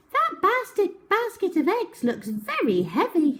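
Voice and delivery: put-on very high chirpy girl; high helpful little voice; little Miss Quick voice. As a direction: squeak it up high and eager. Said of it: "she said".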